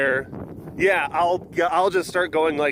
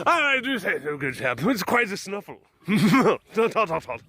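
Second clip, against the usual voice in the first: mock British toff accent, higher; posh British accent; 3 semitones higher than usual. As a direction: play a stuffy English gentleman in British accent. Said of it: "we don't all talk like that".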